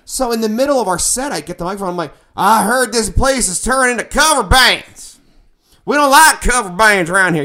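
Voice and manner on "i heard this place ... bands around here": put-on Southern accent. Trash-talking the crowd from the stage, in a loud Southern accent. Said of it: "my best Southern accent".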